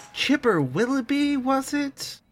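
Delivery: high, sly inquiring voice; lilting high questioning voice; high character voice. Acted. Barnabas addressing Chipper.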